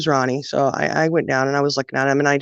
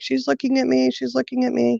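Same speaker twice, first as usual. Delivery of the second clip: high, soft whiny little-girl voice, lightly imitated; upset toddler whine; 9 semitones higher, 3 dB quieter than usual. Toddler sister complaining to mother from the bath, mock whine. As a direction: mimic a tattling toddler, pitched way up. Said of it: "Candy got upset and was like".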